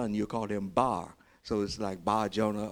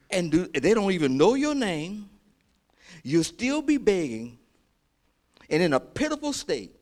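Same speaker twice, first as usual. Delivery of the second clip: high, loud challenge to the congregation; impassioned pulpit exhortation; full-force preaching; 9 semitones higher, 7 dB louder than usual. Real, not acted. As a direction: press the point home with raised voice.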